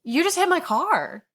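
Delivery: imitated shock. Driver retelling the man whose car she hit, in shock. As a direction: mimic a man slowly taking in the shock. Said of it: "in shock".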